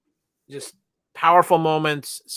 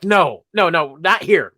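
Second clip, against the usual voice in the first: loud, higher mock dismay; retold protest; 4 semitones higher, 8 dB louder, slower than usual. Fan as every episode ends, loud mock protest. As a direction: cry out in playful protest. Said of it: "and I'm like".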